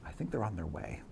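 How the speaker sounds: low, very soft deadpan; understated murmur from a lookout; hushed crew remark. Acted. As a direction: drop right down and say it quietly. Game master voices distant ship crew.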